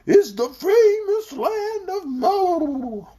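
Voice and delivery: high, very slow, grand proclamation; solemn bumpy announcement. A narrator's reading. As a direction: draw it out impressively in a wobbly high voice. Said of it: "announced in a solemn, impressive voice".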